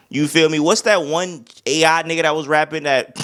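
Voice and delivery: ordinary talk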